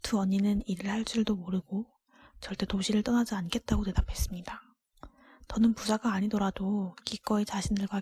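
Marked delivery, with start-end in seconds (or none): none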